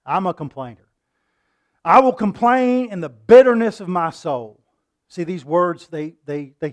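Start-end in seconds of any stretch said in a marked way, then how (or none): 1.8-4.6 loud bitter preaching. Acted. Preaching Job's bitter words.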